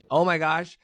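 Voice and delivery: high, gushing replay of grateful excitement; retold excitement. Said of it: "I was so excited".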